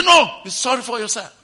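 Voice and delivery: loud mocking retort with a laugh; retorting sharply. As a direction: throw it back at him.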